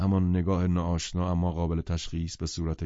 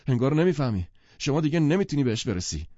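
a little alarmed; 8 semitones higher, 6 dB louder than usual. Acted. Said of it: "گتسبی کمی هراسان گفت".

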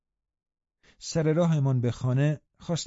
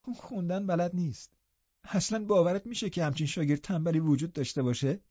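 higher voice, amused disdain; mocking young invalid cousin; 5 semitones higher, 4 dB quieter than usual. Cousin ridiculing the illiterate lad to the girl, amused. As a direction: tease as a spoilt youth.

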